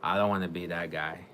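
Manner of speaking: normal talking voice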